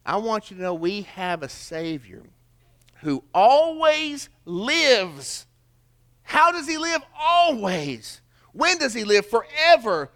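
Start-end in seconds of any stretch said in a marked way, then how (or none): 3.0-10.1 high, loud, slow preacher's call; preaching at full force. Acted. Preacher calling out to his congregation about the Savior, full force. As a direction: ring it out to the congregation, drawn out.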